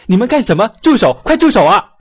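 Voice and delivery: high, loud desperate yell; shouting for help. Acted. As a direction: scream at them to stop. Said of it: "大声地叫喊起来".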